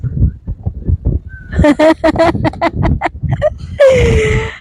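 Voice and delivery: high, drawn-out laugh. Real, not acted. Wife laughing at her husband's side eyes, real high laughter. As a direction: burst out in long, high laughter.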